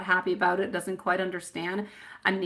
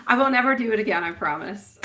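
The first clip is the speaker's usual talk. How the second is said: usual speaking voice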